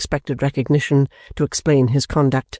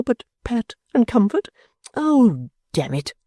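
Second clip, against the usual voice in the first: Mantalini grovelling; 5 semitones higher than usual. Acted. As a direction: beg like a naughty child. Mantalini pleading with wife.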